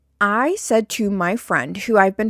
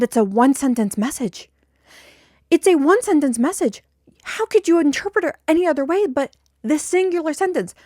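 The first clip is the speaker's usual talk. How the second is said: exasperated retort; 7 semitones higher than usual. Retold or imitated. Retold heated retort to friend.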